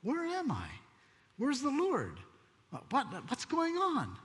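high, soft, lost questions; waking up confused and adrift; bewildered. Retold or imitated. Backslider suddenly realizing he has drifted from the Lord, high and lost. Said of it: "you go".

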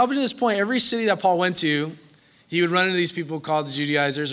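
usual speaking voice

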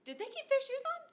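very high, astonished retelling; high-pitched mock amazement; incredulous question. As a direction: act out baffled disbelief, pitch way up.

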